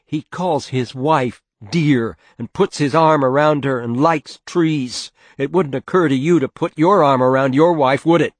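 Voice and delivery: loud, high, bitter reproach. Acted. Mother comparing father to a fonder husband, bitter. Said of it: "mother's voice said bitterly".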